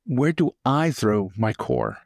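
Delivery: ordinary talk, nothing marked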